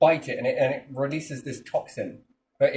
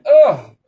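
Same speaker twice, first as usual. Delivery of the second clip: very loud retching ugh; disgusted grunt; 4 semitones higher, 14 dB louder than usual. Retold or imitated. Creature recoiling from the chemical with a loud ugh. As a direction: blurt a repelled grunt.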